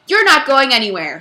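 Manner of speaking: loud shouted order; shouting. Acted. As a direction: shout to stop him leaving. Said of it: "shouted Mister Kidswatter".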